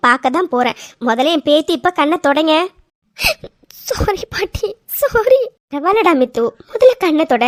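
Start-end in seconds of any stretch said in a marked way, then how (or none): 3.9-5.4 higher, sobbing plea; weeping apology, voice cracking; crying sorry. Acted. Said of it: "நீ அழுகையை நிறுத்த மாட்டேன்". (விம்மி அழுதபடி சாரி)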